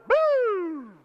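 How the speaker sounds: sudden high, loud siren whoop; imitated police siren. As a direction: whoop like a squad-car siren.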